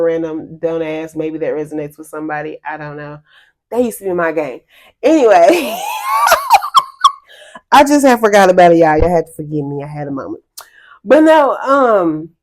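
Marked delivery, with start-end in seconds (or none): 5.9-7.1 very high, loud laugh; shrieking laugh. Real, not acted. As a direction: burst out in a squealing laugh. Laughing after game memory.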